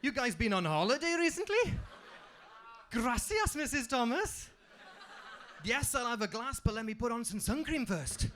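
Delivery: put-on high, loud, wide-eyed kid voice; amazed kid. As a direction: act dazzled by a bottle of Lilt. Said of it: "you'd be like".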